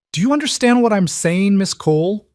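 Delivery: menacing question, pitched well up; menacing high-pitched question. Acted. Mobster threatens Elena on phone.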